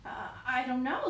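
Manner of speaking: ordinary delivery, nothing marked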